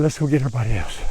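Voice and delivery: usual speaking voice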